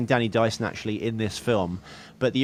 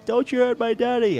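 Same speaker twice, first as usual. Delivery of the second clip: put-on high, pleading little boy voice; kid's plea; 12 semitones higher than usual. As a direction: squeak it like a scared kid begging. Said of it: "the bit where he goes".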